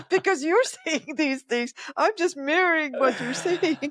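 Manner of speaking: words broken by real laughter; giggling as she tells it; laughing while talking. Real, not acted. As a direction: laugh your way through the line. Laughs through her retold line.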